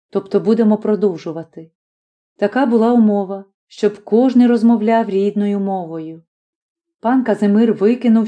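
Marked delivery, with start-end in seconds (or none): none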